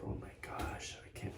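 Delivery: breathless whispered gasp; stunned hushed whisper; emotional gasp. Retold or imitated. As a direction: retell his choked-up whisper. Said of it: "he's all emotional".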